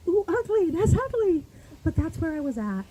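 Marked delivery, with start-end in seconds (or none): 0.1-1.4 self-mocking wince. Real, not acted. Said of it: "And I was so ugly".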